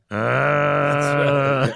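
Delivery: mock monster moan, held; lightly voiced Frankenstein; drawn-out monster "ah"; Frankenstein groan. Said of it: "he would just be going".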